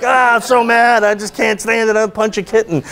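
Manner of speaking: high, hammed-up mock fury; retold exasperation. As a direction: act out being at the end of your patience. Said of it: "I literally wanted to hurt everybody".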